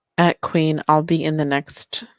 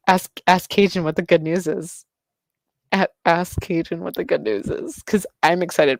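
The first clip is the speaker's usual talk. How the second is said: ordinary talk, nothing marked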